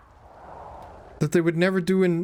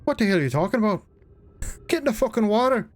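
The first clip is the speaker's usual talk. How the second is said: higher, fast Newfoundland brogue, half Irish; mock Newfie accent; 6 semitones higher than usual. Streamer jokingly voicing a Newfie telling him to swim, Newfie accent. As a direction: bark it like a rough Newfie fisherman. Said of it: "I guess that's more Irish than Newfie".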